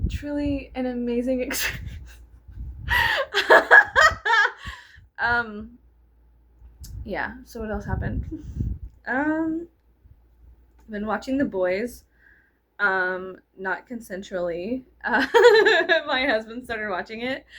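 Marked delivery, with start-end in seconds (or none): 1.9-4.8 high, loud burst of laughter. Real, not acted. Vlogger cracking up at her own joke. 15.3-16.0 loud, high burst of laughter. Real, not acted. Vlogger laughing about the show her husband picked.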